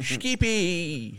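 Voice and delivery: high hammed-up Australian call; mock Australian accent. Showing co-hosts that Australian sounds like a joke, hammed-up Australian. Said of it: "Australian accents do generally have this".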